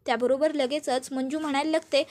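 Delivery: ordinary delivery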